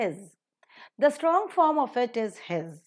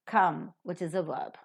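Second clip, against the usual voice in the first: usual speaking voice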